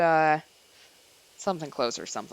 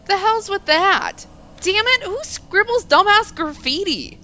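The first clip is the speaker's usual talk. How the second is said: higher, louder, scowling outrage; a scared teen snapping at graffiti, loud and higher; blustering outburst; 12 semitones higher, 5 dB louder than usual. Acted. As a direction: bluff loudly to cover the fear. Sho blusters to hide fear.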